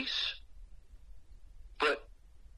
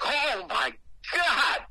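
shocked cry; 8 semitones higher, much slower than usual. Retold or imitated. Retold stab of pain.

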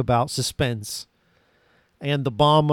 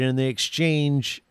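normal talking voice

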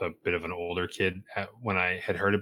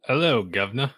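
hammed-up British greeting; mock British accent; 5 semitones higher, 4 dB louder than usual. Guest greets host in a jokey fake British accent. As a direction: put on a cartoon British accent. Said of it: "was that Australian?".

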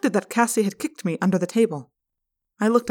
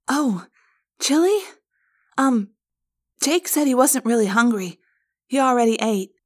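put-on higher, hesitant girl's voice; worried girl; 4 semitones higher than usual. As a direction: make nervous excuses for a dinner guest.

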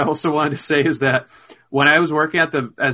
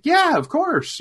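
high cheery reply; 12 semitones higher, slower than usual. Retold or imitated. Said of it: "I was like".

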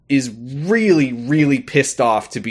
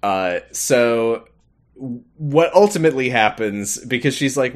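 usual speaking voice

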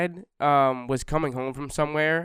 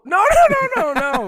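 high, loud no no; loud protest; 10 dB louder than usual. Real, not acted. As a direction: cut him off loudly. Denying loudly, laughing.

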